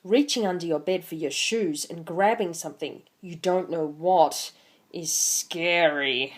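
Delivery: ordinary talk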